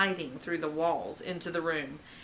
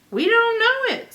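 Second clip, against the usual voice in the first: put-on little boy voice; 13 semitones higher, 8 dB louder than usual. Little Mac's high voice.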